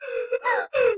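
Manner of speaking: high, gasping sob sound acted out; mock heaving sob; demo heaving sob. Shown as a demonstration. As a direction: show how hard you sobbed, with one big gasping heave. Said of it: "heaving sobbed cry".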